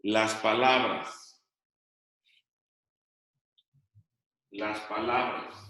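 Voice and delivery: dictating slowly, word by word; slow dictation. Shown as a demonstration. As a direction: spell it out for students copying it down. Teacher dictating to class. (dictado lento, palabra por palabra)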